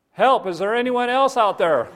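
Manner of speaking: high, loud holler for rescue; desperate high-pitched shout; shouting for help. Acted. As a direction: yell out for anybody else. Jack calls for someone else.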